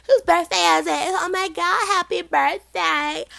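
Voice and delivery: very high, loud, stretched-out squeal; drunk party girl. Retold or imitated. Drunk girls greeting the birthday girl, high excited squeal. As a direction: shriek birthday wishes like a tipsy girl. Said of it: "a lot of drunk girls coming up to me like".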